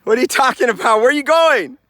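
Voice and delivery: high, loud questions; calling out. Pretend. Hiker shouting after someone walking off, loud high calls.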